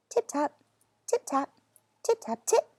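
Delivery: crisp tiny tip-tap, rising on the last; playful tapping rhythm for mice; tapping sounds. Acted. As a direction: mimic little mice tapping.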